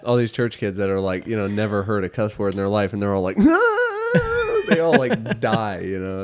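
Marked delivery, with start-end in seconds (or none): 3.4-4.6 high shrieking laugh. Real, not acted. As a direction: let out a piercing laugh. Screaming laugh at punchline.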